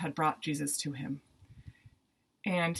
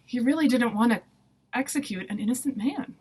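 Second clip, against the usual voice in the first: usual speaking voice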